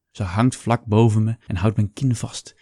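ordinary delivery, nothing marked

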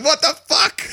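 high startled what the fuck; startled waking. Retold or imitated. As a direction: blurt it out shocked and high.

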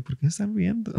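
high, soft bewildered plea; confused. Retold or imitated. Comedian confused by the laughing crowd, high bewildered hush. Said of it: "yo estaba súper confundido".

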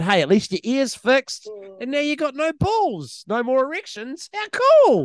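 high, bright mock consolation; mock cheerful. Doctor cheerfully consoling the patient, high and hammed-up. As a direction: sell the bad news as great.

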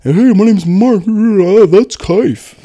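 slow, high, loud caricature voice; hammed-up buddy impression. Buddies doing an impression of Mark, hammed-up caricature. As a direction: belt out a goofy high-pitched mock introduction. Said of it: "my buddies would do an impression".

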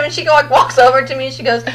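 ordinary talk

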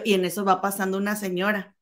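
usual speaking voice

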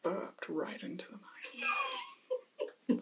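embarrassed whisper after burping; hushed, sheepish apology. Real, not acted.

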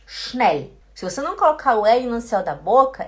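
usual speaking voice

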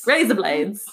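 attempted Aussie accent, r dropped; lightly put-on Australian accent. Shown as a demonstration. Demonstrating the trick phrase, Australian accent. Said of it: "In an Australian accent".

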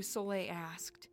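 ordinary delivery

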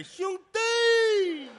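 very high, loud, slow shout of 兄弟; ringing shout. Acted. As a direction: bellow 兄弟 crisply. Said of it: "越叫越脆声".

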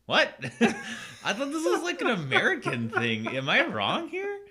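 high, loud, laughing through a baffled question; giggling at her own confusion; laughing disbelief. Retold or imitated. Telling a friend about the movie, high laughing disbelief.

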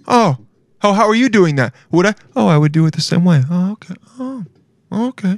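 mock coworker switching from impressed to disdainful; snooty observer. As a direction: react like a picky critic. Acting surprised, disapproving observer.

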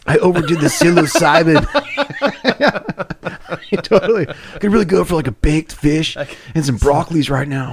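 lazy stoner craving food; stoned. Pretend. Playing the stoned owner.

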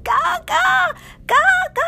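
put-on high, loud child's call; calling for Gaga. Lost girl calling her grandma, high and loud. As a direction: shout the name in a panic. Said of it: "running and calling".

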